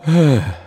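heavy acted sigh; deep sigh. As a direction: let out a deep, loud sigh. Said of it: "with a deep sigh".

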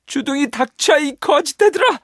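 high, loud furious shout; little boy screaming with fury; angry boy yell. Acted. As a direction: hurl it at the hens in a rage. Nils yells at hens.

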